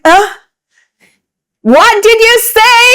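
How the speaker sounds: imitating loud clerk. Retelling the New York ticket counter, loud mock yell. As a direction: shout it back like a deaf clerk. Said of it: "So I was like".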